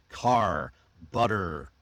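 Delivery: CAR and BUTTER with drawn-out final R, low; post-vocalic R. Shown as a demonstration. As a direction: let the R ring at the end of Car and butter. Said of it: "the post-vocalic R".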